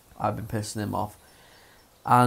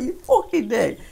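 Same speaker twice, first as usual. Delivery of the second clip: higher, far-off mock outraged voice; higher hammed-up indignation; mock outrage; 11 semitones higher, 4 dB louder than usual. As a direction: playfully scold your coworker.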